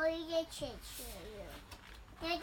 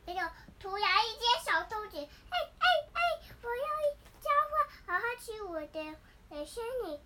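high squeaky bunny; little rabbit voice; 7 semitones higher, 6 dB louder than usual. Acted. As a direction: talk like an eager little rabbit.

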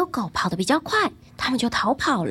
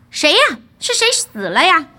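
surprised questioning; 3 semitones higher, 7 dB louder than usual. A narrator's reading. Son lifting his head on hearing of a death, surprised. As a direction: ask it loudly, taken aback. Sleeper startled by news of death.

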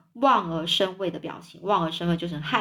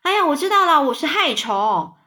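gleeful proclamation; 6 semitones higher, 6 dB louder than usual. A narrator's reading. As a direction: announce it loudly with a big grin. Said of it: "一边就宣告说， 一边咧嘴大笑".

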